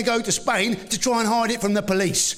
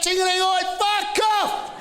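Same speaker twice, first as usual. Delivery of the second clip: swearing bell shout; 10 semitones higher, slower than usual. Pretend. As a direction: yell it like a furious talking bell. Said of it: "Maybe one that swears".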